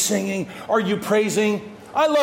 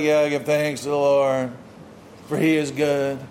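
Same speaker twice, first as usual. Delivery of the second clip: low, half-hearted mock thanksgiving; unmoved praise; 8 semitones lower than usual. Worshipper who hasn't seen God act, half-hearted praise. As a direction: recite it with no passion, pitched well down. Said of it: "You haven't seen God do these things, and so you're like".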